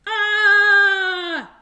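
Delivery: loud high wide-open scream; open-mouthed kid scream. Acted. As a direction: let out a big tantrum scream. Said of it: "opened his mouth really wide and screamed".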